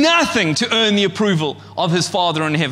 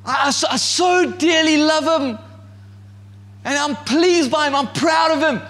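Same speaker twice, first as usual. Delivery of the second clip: gushing love; 6 semitones higher than usual. Pretend. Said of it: "He's like".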